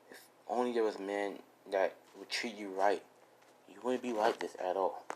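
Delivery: soft, gentle, comforting voice; lightly voiced tender comfort for a sad friend; tender reassurance. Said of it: "he's like".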